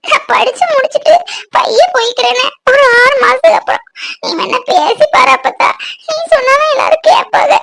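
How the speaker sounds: high-pitched sobbing plea; tearful pleading. Acted. Granddaughter begging grandmother to let her stay, tearful pleading. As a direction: beg through tears, voice pitched high. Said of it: "அழாதம்மா, அழாத".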